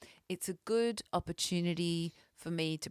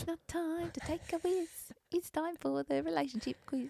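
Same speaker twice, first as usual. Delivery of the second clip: high sweet chipmunk voice; 11 semitones higher, 7 dB quieter than usual. Shown as a demonstration. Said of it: "sweet your voice sounds in that sting".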